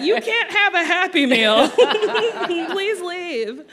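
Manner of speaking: high, laughing mock dismissal; a laughing hint of a stern cashier; curt worker. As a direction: playfully shoo out an adult. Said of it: "They're like".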